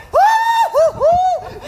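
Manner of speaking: loud, high whoops of admiration; whooping delight. Acted. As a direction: swoon over him out loud. Nurse praises Romeo's body.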